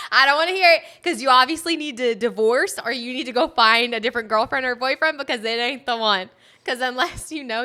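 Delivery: loud, high, fired-up telling-off; loud high scolding; heated rant. Real, not acted. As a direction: blast the critics with it.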